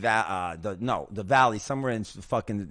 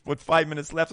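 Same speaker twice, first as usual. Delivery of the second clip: ordinary delivery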